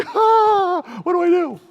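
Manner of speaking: hammed-up helpless husband; mock panic. Husband facing his wife's whitecaps, mock panic.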